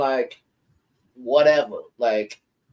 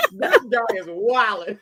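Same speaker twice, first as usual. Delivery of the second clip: usual speaking voice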